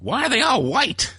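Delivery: high, put-on voice, baffled; high-pitched hammed-up wonder; mock puzzled question. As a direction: ask it like a bewildered onlooker. Retold musing while watching TV.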